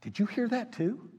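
high, hushed question to a colleague; startled whisper. Retold or imitated. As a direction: ask it under your breath, spooked. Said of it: "looked at each other like".

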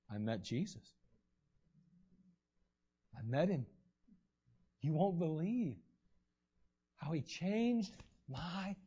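low, soft, sincere; quiet testimony. Retold or imitated. The healed man telling his townspeople he met Jesus, quiet and sincere. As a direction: tell it gently, full of wonder.

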